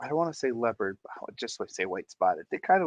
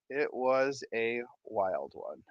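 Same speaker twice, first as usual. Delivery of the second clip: usual speaking voice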